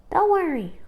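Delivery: high, lightly voiced young sister; reassuring sister. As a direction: comfort her brother gently. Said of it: "Liza told him".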